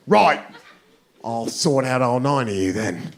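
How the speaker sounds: mock British hard man; British accent. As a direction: size up the group in a put-on British accent. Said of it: "very drunk British guy".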